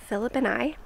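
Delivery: usual speaking voice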